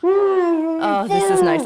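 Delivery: cackling glee over a cut-off finger; high, loud gleeful whoops; gleeful shout. Acted. Villain gleeful after cutting finger.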